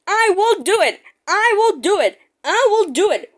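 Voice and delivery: little engine puffing hard. Acted. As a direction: push out each word with effort. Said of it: "puffed Edward".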